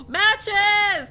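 high, loud joyful cry; excited find. Real, not acted. Player spotting matches in the cave, loud happy cry. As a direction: yell it like you struck gold.

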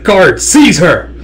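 loud, drawn-out order; acted angry command. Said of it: "getting more and more pissed".

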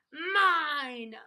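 one loud, drawn-out wail. A narrator's reading. Minosaur losing a tug of war, wailing. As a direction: wail it long and possessively. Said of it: "as he wailed".

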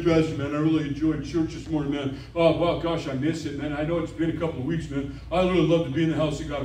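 a hint of an easygoing excuse-maker; casual churchgoer. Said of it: "This guy's coming and says".

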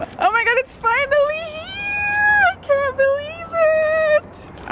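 high, slow, crying squeal; shrieking jackpot winner. Real, not acted. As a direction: shriek with tearful joy. Car finally revealed.

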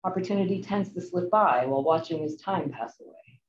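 ordinary talk, nothing marked

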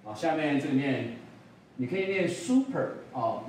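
normal talking voice

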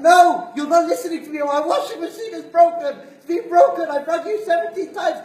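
hammed-up furious caller; angry customer. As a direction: berate the clerk. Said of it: "And you scream at them".

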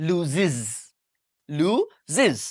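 লুজিজ with a clear extra iz syllable; লুজিজ, ending in a voiced iz; lu-ziz, two clean syllables; say loses. Shown as a demonstration. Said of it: "আমরা বলি".

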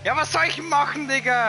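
loud, high exasperated complaint; frustrated outburst. Real, not acted. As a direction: vent at the game, loudly. Frustrated at the game.

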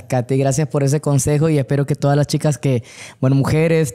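usual speaking voice

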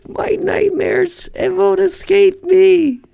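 slow, high mock lament; falsetto wail. Mock wail about old job.

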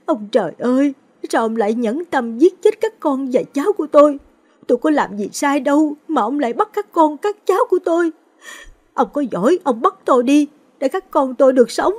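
high, loud keening lament over the coffins; wailing grief. A narrator's reading. Grandmother wailing over her son and grandson's coffins, keening. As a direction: cry out to heaven in raw grief. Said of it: "bà gào thét bên quan tài".

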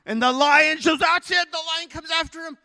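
high, loud, alarmed narration; excited storytelling. A narrator's reading. Preacher narrates the lion coming after him, excited. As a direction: cry out the attack.

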